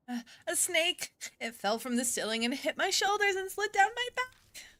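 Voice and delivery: put-on high, shaken girl voice near tears; frightened girl. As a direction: tell it like she's about to cry. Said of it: "She's nearly in tears".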